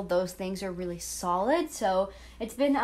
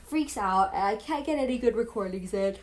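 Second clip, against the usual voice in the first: talking through a yawn; words stretched by a yawn. Real, not acted. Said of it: "Sorry, I keep yawning".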